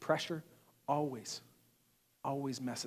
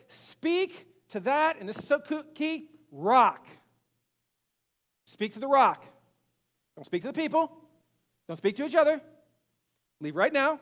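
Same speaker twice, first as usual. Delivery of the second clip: high, loud, stretched-out orders; emphatic divine command; 8 semitones higher, 5 dB louder than usual. Acted. God ordering Moses to speak to the rock, emphatic command. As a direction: call out each command with force.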